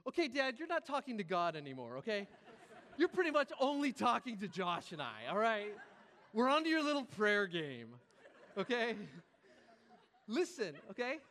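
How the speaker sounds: loud, insistent calling-out; mock indignant. Son calls out dad's pointed prayer, mock indignation. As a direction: cut in loudly, playing fed-up.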